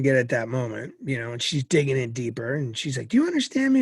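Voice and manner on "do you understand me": much higher, louder insistent mother; stern mom. Retold or imitated. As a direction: demand an answer. Mom presses son.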